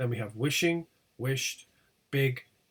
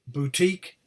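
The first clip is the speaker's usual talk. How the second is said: BOUTIQUE punched loudly on TIQUE; stress on suffix; 2 semitones higher, 6 dB louder than usual. Shown as a demonstration. Teacher showing stressed suffix, stress on TIQUE. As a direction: hit the end of boutique, TIQUE. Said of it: "the suffix is stressed".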